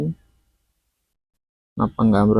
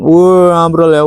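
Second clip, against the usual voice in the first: loud, higher burst of surprise; excited; 5 semitones higher, 7 dB louder, slower than usual. Real, not acted. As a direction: blurt it out at the collapse. Crater wall collapses on camera.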